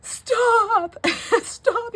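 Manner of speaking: high, loud panicked stop, breaking into a laugh; shrill stop through laughter. Retold or imitated. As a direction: squeal it out in a laughing mock panic. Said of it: "I was like".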